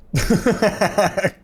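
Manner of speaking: high, loud burst of laughter; laughing. Real, not acted. Streamer reacting to Critias's joke, bursting out laughing. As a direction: crack up suddenly. Cracks up at chat comment.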